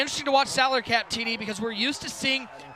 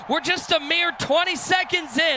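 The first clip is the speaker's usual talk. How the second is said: punchy, raised commentator voice; play-by-play call; 6 semitones higher, 4 dB louder than usual. Real, not acted. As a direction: call the score with energy, louder and higher. Live play-by-play.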